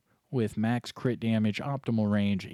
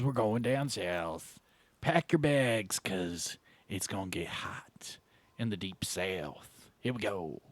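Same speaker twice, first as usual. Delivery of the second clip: southern hippie voice; 3 semitones lower, 5 dB quieter than usual. Pretend. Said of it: "southern hippie voice".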